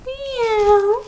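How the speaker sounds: high, soft kitten meow; tiny mewing cat. Shown as a demonstration. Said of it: "and cat".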